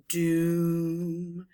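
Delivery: "doom" held long and level; one long, sustained "doom"; stretched "doom". Shown as a demonstration. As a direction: draw out the vowel of "doom". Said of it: "I wanted to stretch that word out".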